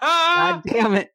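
laughing through the words. Real, not acted. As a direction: crack up mid-sentence. Laughs at his own slip.

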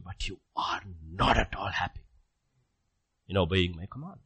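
whispered, soft and low; whispered lament. Acted. Preacher voicing God's sorrow at unhappy children, whispered. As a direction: drop to a whisper for the sad point.

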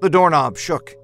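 ordinary delivery, nothing marked

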